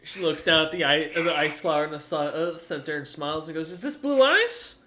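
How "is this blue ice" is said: delighted surprise. Acted. Gertrude admires gift.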